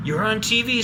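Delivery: high, loud, thrilled little-sister shriek; excited scream. A narrator's reading. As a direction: shout the news across the house. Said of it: "screamed D W".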